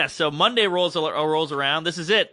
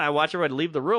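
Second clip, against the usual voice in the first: usual speaking voice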